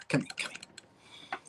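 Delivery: whispered and slow; hushed call. Retold or imitated. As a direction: whisper him over without the lady noticing. Calling Chris over discreetly.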